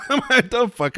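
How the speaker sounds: high-pitched, laughing comeback; laughing protest. Real, not acted. Host answering his co-host's teasing, laughing in protest. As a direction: protest the insult through a laugh.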